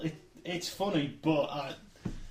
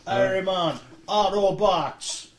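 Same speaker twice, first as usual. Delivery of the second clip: hero announcer voice; 3 semitones higher, 6 dB louder, much slower than usual. Pretend. Hammed hero call.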